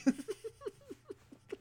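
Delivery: squealing burst of laughter; high-pitched laugh. Real, not acted. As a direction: break into a high giggle. Laughing at own story.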